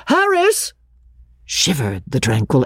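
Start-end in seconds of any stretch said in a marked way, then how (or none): 0.1-0.7 high shouted name. A narrator's reading. Mother calling across the garden, a high shout. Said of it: "her mother's shout of".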